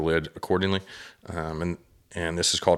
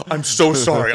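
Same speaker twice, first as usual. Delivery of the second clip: higher and much louder, breathless rush of sorry; worked-up apology; 9 semitones higher, 10 dB louder than usual. Retold or imitated. Apologising for gripping her shoulder, loud excited blurt. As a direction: blurt it out still buzzing. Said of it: "I'm really worked up right now".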